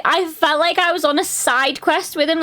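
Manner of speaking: usual speaking voice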